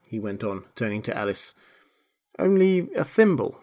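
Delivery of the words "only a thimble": high, small, mildly sad reply. A narrator's reading. Alice showing what her pocket holds, mildly sad. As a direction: answer high with a touch of sadness. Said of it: "said Alice sadly".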